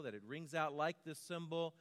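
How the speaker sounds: usual speaking voice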